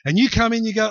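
ordinary delivery

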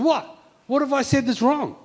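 high-pitched defensive retort; offended comeback; indignant protest. Acted. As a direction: push back at a scolding brother, pitched well up. Acted protest to scolding brother.

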